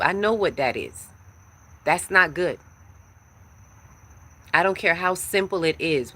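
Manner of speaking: ordinary delivery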